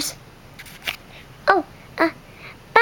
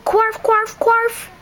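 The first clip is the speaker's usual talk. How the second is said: higher, squeaky mixed quack-bark; a duck trying to bark; high quarf; 7 semitones higher, slower than usual. Acted. Duck attempting the dog's bark, high. Duck tries dog noise.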